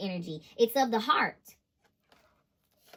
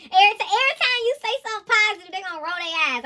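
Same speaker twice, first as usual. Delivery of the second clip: excited; 7 semitones higher, 6 dB louder, faster than usual. Real, not acted. Excited high telling.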